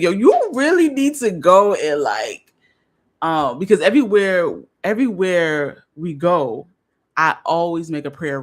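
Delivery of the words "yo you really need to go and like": partner's voice. Retold or imitated. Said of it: "he's like".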